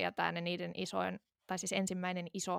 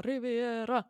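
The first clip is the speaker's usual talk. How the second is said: re-enacted concert yell, raised pitch and volume; imitated shout; 4 semitones higher, 5 dB louder, much slower than usual. Audience member requesting a song from the band, mock shout. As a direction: call it out like a song request, half full force. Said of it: "mä huusin hirveän kovaa".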